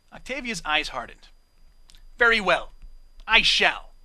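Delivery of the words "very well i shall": cold. Acted. Octavia's eyes hardened, cold reply.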